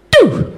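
one high falling zap; sudden high electronic "doo"; imitated crossing signal chirp. Comic mimics the first sound of the crossing, high chirp. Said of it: "the first thing I hear is".

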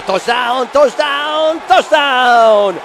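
high, loud escalating goal-style yell; touchdown call. Real, not acted. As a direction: scream the touchdown over and over. Live touchdown call on broadcast.

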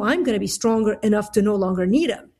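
normal talking voice

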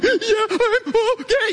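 very high, squeaky mock sobbing; can't-talk crying. Bawling husband answering his wife, imitated can't-talk crying. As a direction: squeeze the words out through hammed-up tears. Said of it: "I can't even talk type crying".

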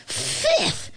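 spluttered spit of a word; disgusted spitting. A narrator's reading. Spitting out tasteless beans.

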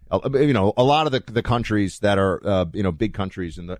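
normal talking voice

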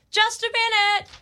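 high voice calling from inside the house; homeowner calling out. Retold or imitated. Homeowner answering the doorbell, calling out. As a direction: call out from behind the door. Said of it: "I hear someone say".

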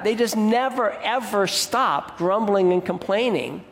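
normal talking voice

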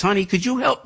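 high, pleading little voice; mock helpless plea. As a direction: beg for help in a put-on helpless tone. Mock needy parent plea.

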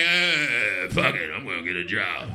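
low, grudging mumble; imitated homeless man. Homeless man giving up begging in the heat, grudging. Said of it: "homeless like".

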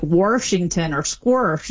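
Washington as "Warshington", squirsh with an added R; slow "Warshington", R-coloured squirsh; intrusive R. Shown as a demonstration. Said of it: "then there's the intrusive R".

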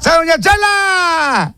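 high, drawn-out hail; cheering shout. Real, not acted. Host saluting caller's mother, cheering shout. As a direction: holler it with the last word stretched.

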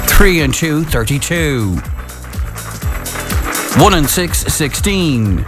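calling each number out, then repeating it slowly; bingo caller. Acted. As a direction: sing out the pair, then drawl the full number.

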